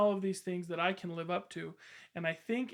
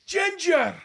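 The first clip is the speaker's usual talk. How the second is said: much higher and much louder mock Scottish accent, like a rallying chieftain; hammed-up Scottish pep talk; 10 semitones higher, 10 dB louder, much slower than usual. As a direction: shout the names in an overblown Scottish accent. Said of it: "pep talk in a Scottish accent".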